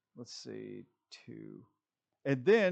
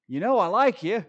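Gene dodging again, much louder and higher; louder second reply; 7 semitones higher, 10 dB louder than usual. Acted. Teasing pastor repeats Gene's dodge, loud and high. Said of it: "And he's like".

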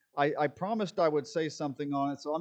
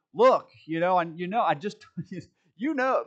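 hammed-up stammering, louder and higher with a laugh; flustered Gene; 4 semitones higher, 8 dB louder than usual. Gene fumbling to answer, loud and laughing. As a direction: fumble for words through a laugh. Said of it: "And then Gene says".

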